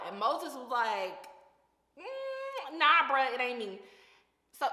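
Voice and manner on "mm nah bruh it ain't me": high, drawn-out "mm" then a loud "nah, bruh"; reluctant refusal. Retold or imitated. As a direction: brush it off like you want no part of it. Moses refusing God, voiced.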